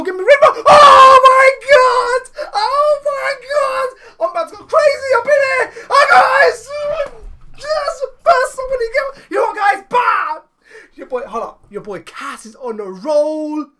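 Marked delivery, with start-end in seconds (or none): 0.0-10.4 real jackpot scream, very high and loud; ecstatic screaming. Real, not acted. Player hits five star on first pull, ecstatic screaming. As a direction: lose it completely, drawn-out high shouts.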